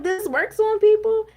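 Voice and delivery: high, disbelieving question; incredulous. Retold or imitated. Reacting to the news anchors' tone, disbelieving. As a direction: sound floored by it. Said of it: "And I'm like".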